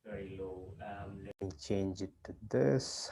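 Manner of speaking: faint, distant mic voice; barely audible. Real, not acted. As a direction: voice barely coming through. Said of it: "but your voice is very low".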